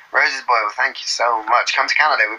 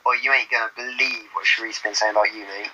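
put-on Bristol accent; 4 semitones lower, 4 dB quieter than usual. Gossiping to a mate about Sheree, broad Bristol accent. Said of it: "I'm from Bristol".

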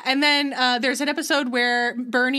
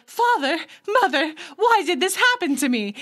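high, hammed-up plea of a young puffin; mock puffin child voice; 6 semitones higher, 3 dB louder than usual. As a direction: play the hurt little puffin asking her parents, hammed up. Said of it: "Una didn't go".

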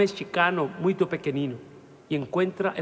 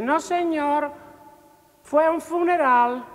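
servant's answer; 10 semitones higher than usual. Acted. Servant at door.

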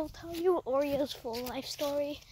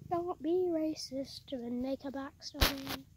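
ordinary talk

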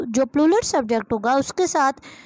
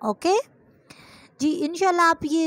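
ordinary talk

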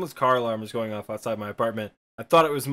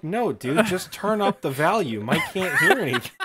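put-on higher, exasperated teen with VAL-yum for "volume"; mock clueless teenager; 7 semitones higher, 3 dB louder than usual. Imitating younger self baffling friends, high laughing protest. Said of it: "just being a stupid, stupid teenager".

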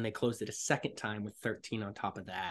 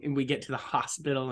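ordinary talk

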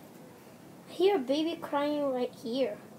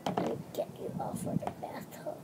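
whisper; 10 dB quieter than usual. Pretend. Whispered bath play.

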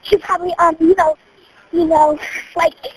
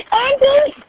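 calling out; 5 semitones higher than usual. Real, not acted. Calling out during fire.

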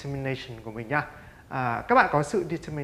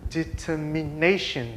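"Determination" said very slowly, syllable by syllable, stress on "na"; slow stressed model; much slower than usual. Shown as a demonstration. Teacher modelling "Determination" slowly for pupils to repeat. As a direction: stretch out "Determination", leaning on "na". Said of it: "Chúng ta sẽ nhấn vào cái tiếng này".

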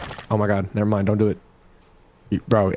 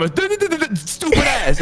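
drawn-out, higher stammer; demonstrated stutter; 10 semitones higher, much slower than usual. Shown as a demonstration. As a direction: trip on the first sound over and over. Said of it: "I stutter one time".

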